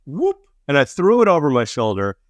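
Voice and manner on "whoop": giddy high whoop; playful whoop. Retold or imitated. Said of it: "I went".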